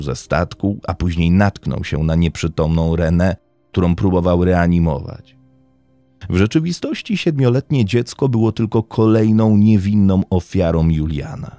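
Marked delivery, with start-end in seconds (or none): none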